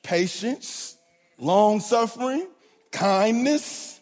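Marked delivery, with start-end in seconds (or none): 0.0-1.0 quoting God. Acted. God's voice quoted slowly.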